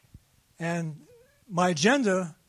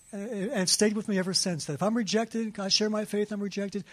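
usual speaking voice

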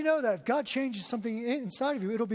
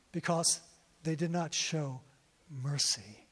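lower, softer, slow and solemn; voice of God; 6 semitones lower, 3 dB quieter than usual. Retold or imitated. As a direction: let God answer gently from above. God's answer heard in prayer.

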